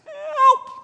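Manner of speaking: very high, thin call for help; tiny distant cry. Retold or imitated. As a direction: call help from far away. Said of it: "you hear this".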